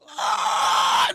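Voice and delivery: loud, sharp demon screech; imitated screech. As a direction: let out a short shrieking screech. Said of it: "Just screeches at him".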